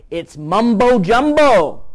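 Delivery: loud, slow, drawn-out MUMBO JUMBO; mocking dismissal. Host quoting the Fox News lady, mocking. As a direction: stretch out the phrase in loud derision.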